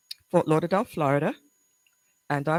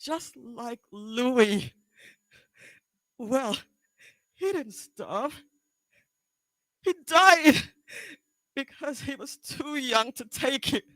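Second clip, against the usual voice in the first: higher-pitched, halting confrontation with long pauses; anguished, accusing daughter; 13 semitones higher than usual. Acted. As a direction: confront Mom, close to tears, with bursts of force. Audition monologue, daughter confronting mother.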